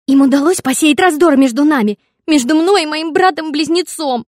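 loud high whiny outrage; tearful rage. A narrator's reading. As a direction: wail it tragically. Daughter wails to father. (плаксивая ярость)

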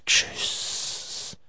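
ordinary talk, nothing marked

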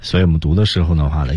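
ordinary talk

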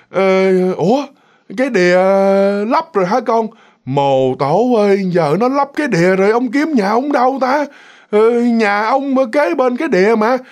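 put-on slow, drawn-out old man's voice; feeble old man. Confused old man learns his pond was filled, slow and faltering. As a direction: draw the words out, bewildered and halting. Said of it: "một cái giọng người già, mà cái giọng nó rất là yếu ớt".

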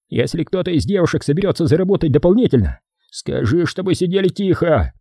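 high, sour boss barking orders; bossy boss voice. Acted. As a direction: lay down the law.

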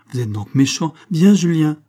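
scold, then call out sharply and high; indignant scolding. A narrator's reading. Girl calling her brother away from the man, indignant scolding.

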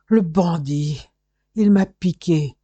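slow, loud indignant cry; drawn-out, forceful accusation; outraged outburst. Acted. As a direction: come round shouting in outrage. Victim's first words on coming round.

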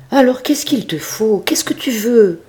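desperate master, voice sinking to a whisper; pleading old farmer. Acted. Farmer begs goat to explain. (le maître désemparé, très aigu puis chuchoté)

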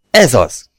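a sudden loud cry of triumph; exclaiming. A narrator's reading. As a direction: shout it out as the light appears. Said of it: "kiáltottam".

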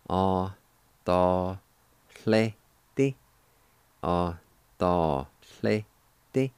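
"A ta tle ti" split into separate syllables; slow syllable-by-syllable teaching demo. Shown as a demonstration. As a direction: pause after each syllable of "A ta tle ti". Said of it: "it's pronounced like this".

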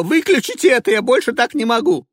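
high, loud, desperate cry to switch it off; hysterical plea. Acted. Passenger begging the driver to kill the radio, shrieking. Ivanova begs to stop radio.

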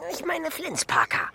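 high, loud gleeful naming; eager scheming burst; excited. Acted. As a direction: blurt the name out in delight. Excited plotting.

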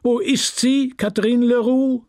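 high, carrying summons; councillor calling out. A narrator's reading. Councillor looks for the missing prize winner, calling out. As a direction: call for someone lost in the crowd. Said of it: "wiederholte der Präfekturrat".

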